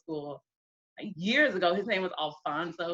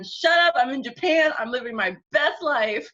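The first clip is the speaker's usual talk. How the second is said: louder, higher, laughing brush-off; carefree shout; 6 semitones higher, 5 dB louder than usual. Retold or imitated. Drunk young woman waving off men on the street, laughing loudly. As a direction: toss it off loudly, giddy and drunk. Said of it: "I was like".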